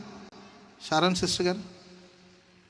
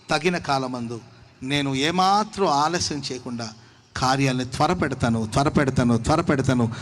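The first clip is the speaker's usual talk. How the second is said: fervent preaching; 3 semitones lower, 4 dB louder than usual. Acted. Preaching God's promise.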